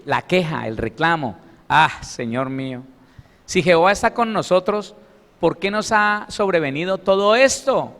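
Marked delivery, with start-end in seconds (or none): 1.7-7.9 rising, louder reproachful question to God; aggrieved complaint. Acted. Gideon complaining to the Lord, aggrieved and louder. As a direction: plead with God as if wronged. Preacher voicing Gedeon's complaint.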